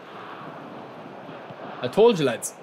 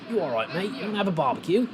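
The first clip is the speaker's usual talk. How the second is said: put-on Aussie lilt, rising at the end; 4 semitones higher, 3 dB quieter than usual. Shown as a demonstration. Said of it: "do a stereotypical Australian accent".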